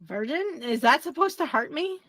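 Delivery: normal talking voice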